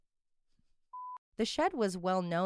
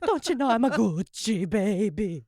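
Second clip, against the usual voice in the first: mock Italian Gucci actor; 2 semitones higher, 5 dB louder than usual. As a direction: ham up the actor's accent. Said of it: "my new favorite noise to make".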